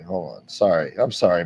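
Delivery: usual speaking voice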